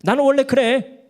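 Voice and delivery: imitating a brash talker, pitched high and loud; brash retort. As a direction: throw it out loud and high, mock-defiant. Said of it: "말을 막 하는 거예요". (막말하는 사람 흉내, 높고 크게 내지르듯)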